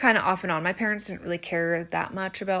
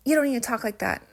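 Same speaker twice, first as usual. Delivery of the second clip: higher, mock scolding sister; imitating critical sister; 5 semitones higher, 4 dB louder, faster than usual. Sister correcting her younger sibling's twang, mildly mock-scolding. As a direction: lightly act out a sister's correction. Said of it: "she'd always comment and be like".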